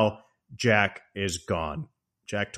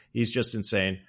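ordinary talk, nothing marked

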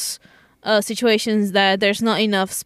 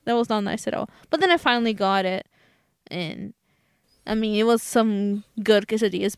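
ordinary talk, nothing marked

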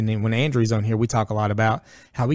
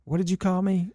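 put-on player confronting kid; 8 semitones higher than usual.

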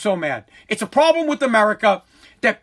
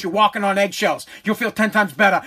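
ordinary talk

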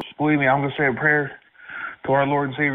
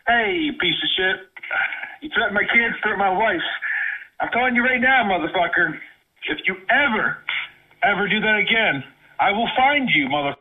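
angry shouting; 6 semitones higher than usual. Real, not acted. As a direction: shout the threat furiously. Threatening the caller.

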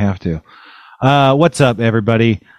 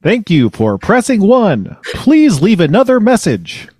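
higher-pitched, deliberate phone-menu voice; automated voicemail system; 8 semitones higher than usual. Acted. Voicemail machine asks caller for another message, high automated prompt. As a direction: recite it like a recorded prompt.

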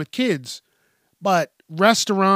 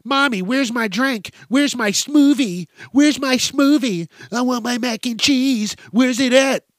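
put-on high, whiny child's voice, getting louder; much higher and louder pestering child; demanding kid; 7 semitones higher, 4 dB louder than usual. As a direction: whine for the smoothie like a spoiled kid. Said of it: "you hear a kid in the corner".

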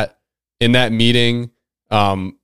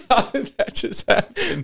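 laughing; 5 dB quieter than usual. Real, not acted. Laughing after the cramp eased.

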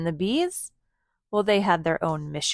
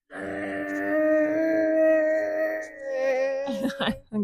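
high buzzing mower imitation; playful engine drone; lawnmower noise; 8 semitones higher than usual. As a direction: make a lawnmower sound for the kids. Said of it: "Good job".

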